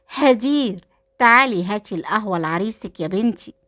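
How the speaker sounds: ordinary delivery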